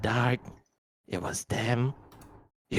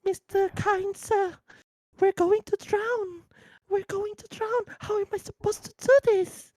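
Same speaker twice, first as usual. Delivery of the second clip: put-on panicked child. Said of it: "the child going to be like".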